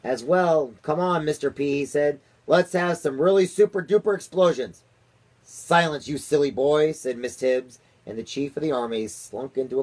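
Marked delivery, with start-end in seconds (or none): 0.0-4.8 high, loud, eager pleading; eager urging. A narrator's reading. Army chief begging the president for explosions, eager and loud. 5.4-6.9 scolding. A narrator's reading. Nanny scolds the army chief.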